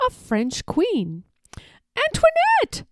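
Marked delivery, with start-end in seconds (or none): 2.0-2.9 the dog crying out to his mistress; barking call. Acted. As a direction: bark out her name as she's led away. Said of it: "I barked as they led her away".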